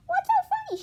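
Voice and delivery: very high, loud bewildered question; a confused girl asks the laughing class; puzzled. Acted. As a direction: ask baffled and loud. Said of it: "she asked".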